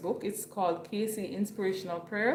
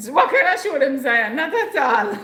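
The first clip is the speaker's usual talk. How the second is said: high, loud, amused protest; laughing exclamation; 6 semitones higher, 10 dB louder than usual. Real, not acted. Streamer refusing to show Zion to viewers, loud laughing protest. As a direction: exclaim loudly, breaking into laughter.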